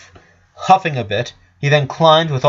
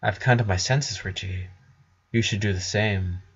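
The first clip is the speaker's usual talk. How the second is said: softer, cold and level, almost hushed; cold quiet; 2 semitones lower, 7 dB quieter than usual. Acted. Flower urges Richard to defect, quietly menacing. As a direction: lean in and urge him with quiet menace.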